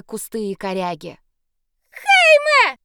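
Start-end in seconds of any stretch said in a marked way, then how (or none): 1.9-2.8 high, loud desperate cry; desperate scream. Acted. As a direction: scream the name with everything. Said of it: "Мой вопль".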